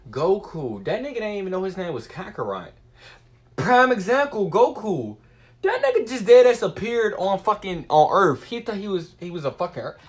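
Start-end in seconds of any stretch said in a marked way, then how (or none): 3.5-8.4 excited. Real, not acted. Excited example.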